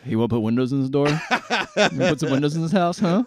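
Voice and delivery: put-on loud, fast hammy Italian accent. Mock Italian contractor selling windows, hammy accent. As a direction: pitch windows like a pushy Italian. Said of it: "enough to do an Italian accent".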